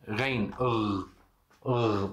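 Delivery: R said deep in the throat like French bonjour. Shown as a demonstration. Teacher shows students the Arabic letter, throaty R. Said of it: "Parece R francês".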